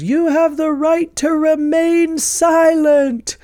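a teen playing policeman, dead serious; earnest kid cop. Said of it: "and he just goes".

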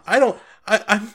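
stammer, caught off guard and flustered. Acted. Eckerd answering the guard captain's accusation, flustered. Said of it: "He looks really flustered".

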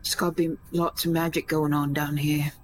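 usual speaking voice

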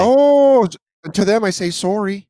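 high-pitched Canadian apology; long high "oh", then apologize as a mock Canadian; mock Canadian accent. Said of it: "did the Canadian voices".

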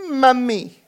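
put-on a child's high call after her mum; toddler calling out. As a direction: call like a little girl at the window. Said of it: "Anna went to the window and went".

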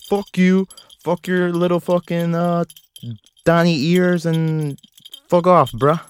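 a lightly acted angry rant at his dog; mock argument. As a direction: pick a fake fight, voice climbing. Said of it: "That was an argument between me and".

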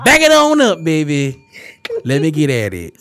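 loud hammed-up lyric parody; mock song command. Host mimicking a song he finds disrespectful, mock command. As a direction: call it out big, then ease down at the end. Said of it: "that slow down song".

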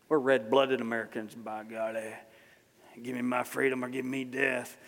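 folksy put-on American patriot accent. Preacher mimicking red-blooded Americans, put-on accent. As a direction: slip into an accent, holding words almost on one note. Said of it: "I went into another, um, accent there".

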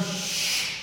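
sharp hush then fast scolding; brusque crowd telling beggar off. Retold or imitated. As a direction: shush him, then snap shut up.

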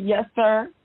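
normal talking voice

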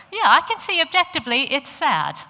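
imitating analytical husband. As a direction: play the husband judging sadness objectively. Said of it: "And he'll go".

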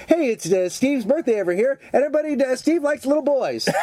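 high-pitched, laughing bad emcee; clumsy mock announcement. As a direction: announce it awkwardly, on the edge of laughter. Acting out a clumsy punk announcement.

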